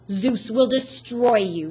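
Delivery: a lightly voiced vengeful Fury; menacing promise. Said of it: "she promised".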